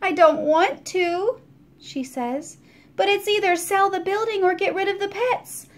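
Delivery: landlady explaining why she must sell; reluctant landlady. A narrator's reading. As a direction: confess it unwillingly.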